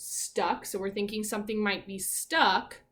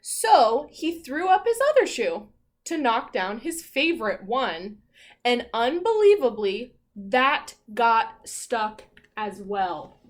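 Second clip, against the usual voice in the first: louder, dramatic picture-book reading; story read-aloud; 8 dB louder, slower than usual. A narrator's reading. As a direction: build suspense for the class.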